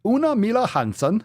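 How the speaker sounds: high, sing-song fake Swedish; hammed-up Swedish lilt on the name; artificial Swedish accent. Shown as a demonstration. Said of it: "artificial Swedish accent or something".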